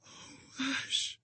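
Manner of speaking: high, soft breathless whisper; dying whisper. A narrator's reading. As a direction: whisper with the last strength. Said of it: "she whispered".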